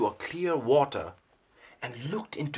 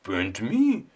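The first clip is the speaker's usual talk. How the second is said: louder, insistent "paint me"; eager owl; 9 dB louder, slower than usual. Acted. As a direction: demand it like an impatient child. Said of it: "the owl went".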